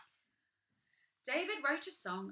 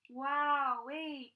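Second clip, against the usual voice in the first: ordinary delivery, nothing marked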